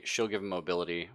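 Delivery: usual speaking voice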